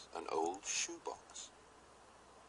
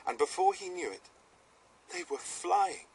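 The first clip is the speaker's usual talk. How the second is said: high, loud thrilled; excited; 10 semitones higher, 5 dB louder than usual. A narrator's reading. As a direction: burst out with wonder. Lifting off into flight.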